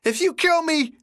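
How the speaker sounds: high, panicked shriek. Acted. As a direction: shriek it like a cornered madman. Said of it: "Ben shrieked".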